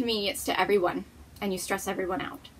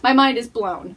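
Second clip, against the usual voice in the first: loud emphasis; 2 semitones higher, 9 dB louder than usual. Real, not acted. Repeats the phrase for emphasis.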